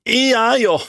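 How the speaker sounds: Kaori refusing, high; girl voice. Acted. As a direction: refuse sharply.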